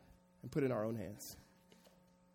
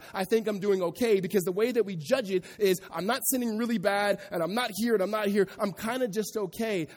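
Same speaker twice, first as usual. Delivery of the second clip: louder, driving preacher voice; preaching with force; 5 semitones higher, 7 dB louder than usual. Acted. Pastor pressing the congregation, raised preaching voice. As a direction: push it out to the congregation.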